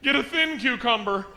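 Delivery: shouted across the aisle at the wife; loud public callout. Acted. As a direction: announce it to the whole store.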